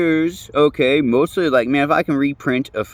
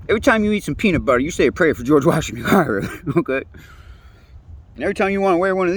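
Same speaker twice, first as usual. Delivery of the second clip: laughing, quick and higher; 5 semitones higher, faster than usual. Real, not acted. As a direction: laugh your way through the punchline. Laughs through his own joke.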